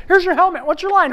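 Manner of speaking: very high, loud rapid-fire questions; frantic scolding. Acted. Crew backstage to late actor, frantic scolding. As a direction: pounce on the latecomer in panic.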